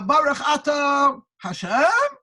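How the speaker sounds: Hashem rising sharply as a question; loud chanted reading, swooping up on Hashem; questioning inflection. Shown as a demonstration. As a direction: put a big question mark on Hashem. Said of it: "he put the question mark, the inflection".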